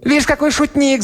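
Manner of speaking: very high, squealing amusement; laughing devil's cry. A narrator's reading. Devil whose tail is grabbed, crying out in amusement. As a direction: squeal it like a devil enjoying a joke. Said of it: "закричал, смеясь, чёрт".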